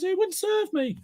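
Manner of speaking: very high, thin sulky squeak; high cartoon string whining to its mates; squeaky string voice. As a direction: complain like a tiny cartoon string.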